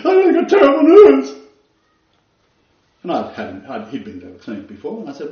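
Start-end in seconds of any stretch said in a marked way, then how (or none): 0.1-1.3 much higher, loud, wailing quote; a crying man blurts bad news; imitating a sobbing patient; distraught man. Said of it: "He said".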